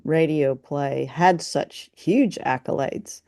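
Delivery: ordinary talk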